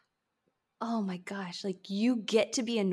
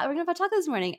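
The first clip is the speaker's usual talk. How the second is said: higher, sing-song mom voice promising a treat; talking to my child; 7 semitones higher, much faster than usual. Pretend. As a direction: announce it brightly to the little boy. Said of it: "I'm pretending like I'm talking to him".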